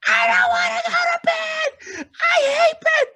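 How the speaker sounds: high, loud crying tantrum shout; screaming upset child. Retold or imitated. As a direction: wail it like a child who refuses bed. Child screaming about bedtime.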